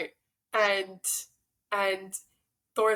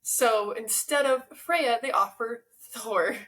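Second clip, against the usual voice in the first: ordinary talk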